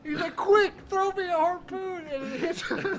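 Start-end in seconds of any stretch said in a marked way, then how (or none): 0.3-2.1 high, loud, drawn-out movie imitation; mock urgent yell. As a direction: cry out in comic panic. Quoting urgent film character.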